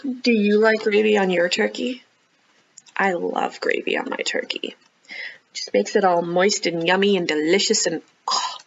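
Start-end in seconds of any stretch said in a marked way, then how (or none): 3.0-5.7 soft unvoiced confession; whisper. Real, not acted. Reader to children about gravy, whispered. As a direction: whisper it like a delicious secret.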